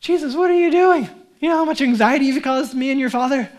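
exasperated mother. Retold or imitated. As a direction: scold him like a frantic mom. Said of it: "They find him in the temple".

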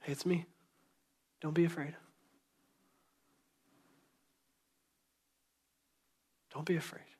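soft and tender, fading to a whisper; gentle Jesus voice. Retold or imitated. Preacher voices Jesus comforting the congregation, soft and tender. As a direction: comfort the listener as Jesus would. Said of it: "the voice of Jesus saying".

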